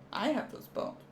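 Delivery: "I have those bones" whispered, with no voice at all. Acted. Marvelling at an X-ray.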